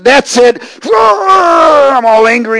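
high, loud yell of exasperation; acted-out snap of temper, loud and high; frustrated outburst. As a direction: blow up into a long hammed-up angry cry. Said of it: "I'm finally getting frustrated".

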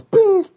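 high loud bing; ding like a car chime; chime imitation.

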